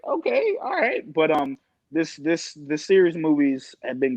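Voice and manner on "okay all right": mock surprise. Reacting to hockey analysis.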